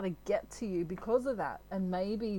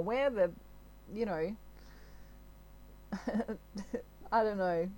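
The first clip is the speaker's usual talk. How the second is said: normal talking voice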